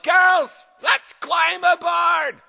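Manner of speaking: high, loud battle cry to her crew; pirate captain yell. Acted. Woman pirate captain ordering her crew to board, yelling. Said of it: "a woman pirate captain yelled".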